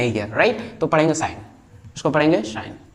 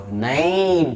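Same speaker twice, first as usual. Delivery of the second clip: ordinary talk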